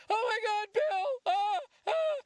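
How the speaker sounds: squeaky excited pleading fan; high-pitched kid. Retold or imitated. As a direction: beg like a starstruck kid. Kid gushing again.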